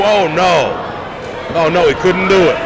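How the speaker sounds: disappointed play-by-play letdown; deflated dismay. Real, not acted. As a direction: let the hope drain out. Comeback attempt fails.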